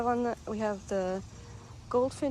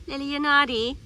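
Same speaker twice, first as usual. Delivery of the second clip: calling the dog; 3 semitones higher, 10 dB louder than usual. Real, not acted.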